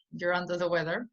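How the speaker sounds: ordinary delivery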